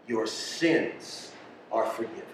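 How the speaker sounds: low, hushed words of forgiveness; gentle pronouncement. Acted. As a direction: drop low and soft, forgiving tenderly. Acted Jesus forgiving the paralytic.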